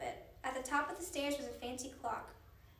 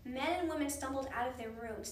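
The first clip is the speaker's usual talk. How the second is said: ordinary delivery, nothing marked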